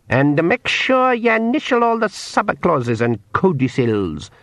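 high, slow Scottish-tinged pixie. Acted. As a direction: lay out terms with pixie cunning. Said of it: "he added".

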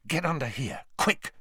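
hushed, urgent order; urgent undertone. A narrator's reading. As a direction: get them hidden fast, keeping it down. Urgent order to hide from others.

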